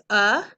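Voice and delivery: a clipped short uh for u; isolated uh sound for the letter u. Shown as a demonstration. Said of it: "That means this says".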